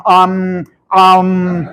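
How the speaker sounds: long level American "um"; American-style filler. Shown as a demonstration. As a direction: stall with a drawn-out American hesitation hum. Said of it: "algunos son más americanos".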